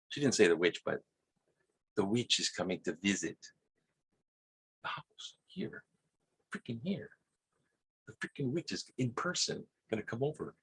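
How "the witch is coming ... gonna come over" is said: hushed, much softer, half-whispered gossip; mom's hushed warning. Retold or imitated. Mom warning him the witch is coming, in a hushed voice. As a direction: lean in and whisper the news. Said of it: "my mom says".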